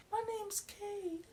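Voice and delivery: very high, slow squeaky voice; mock yourself with a tiny, high-pitched voice; mock regular self. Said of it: "talking like my regular self".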